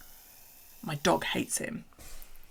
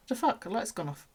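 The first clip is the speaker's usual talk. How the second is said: voicing the snake; 4 semitones higher than usual. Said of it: "he's in there like".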